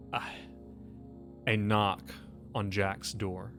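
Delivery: ordinary talk, nothing marked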